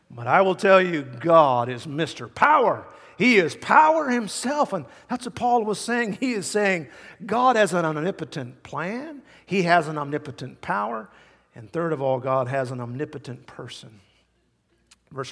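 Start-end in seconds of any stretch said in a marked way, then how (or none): 0.1-11.1 loud, deliberate proclamation; emphatic preaching. Real, not acted. Preacher declaring God's omnipotence to congregation, emphatic preaching. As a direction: declare it boldly from the pulpit.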